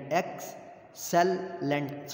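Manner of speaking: slow, "Excellent" stretched syllable by syllable. Shown as a demonstration. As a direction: draw out each syllable of Excellent. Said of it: "three syllable".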